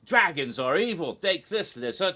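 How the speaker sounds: lightly acted cocky attack; Hiccup, aggressive. Hiccup stabbing poor Smaug, mildly aggressive and douchey. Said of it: "rather aggressively and, and rather, uh, douchily".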